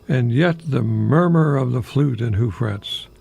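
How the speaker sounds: ordinary talk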